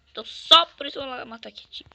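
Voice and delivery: fast loud declaration. Real, not acted. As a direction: rush it out, pumped. Gamer hyping himself to attack.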